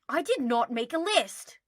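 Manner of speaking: loud, high huffy denial; indignant denial. Acted. Draco huffily denying making a list. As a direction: deny it too hard.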